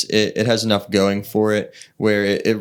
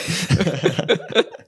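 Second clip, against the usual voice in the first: high giggle; 10 semitones higher than usual. Real, not acted. Laughing at own joke.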